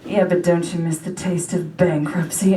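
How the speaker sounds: low, insinuating demon voice; tempting demon. Acted. Demon luring a broke shopper to spend, low and coaxing. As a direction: tempt like a devil on the shoulder. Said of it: "a voice comes in my ear like".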